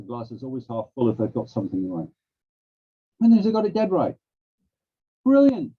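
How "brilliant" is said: ordinary talk, nothing marked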